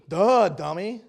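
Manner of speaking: dopey, exaggerated putdown; mocking duh. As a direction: say it like it's painfully obvious. Voices preacher's imagined scornful reply.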